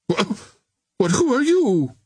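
high, stammered question; startled cry. A narrator's reading. Captain startled at hearing his name, crying out. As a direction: stammer it out in astonishment. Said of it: "he cried".

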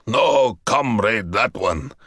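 slow, snarling fury; raging general. A narrator's reading. Enraged general demanding the traitor's death. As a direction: spit each word with hate.

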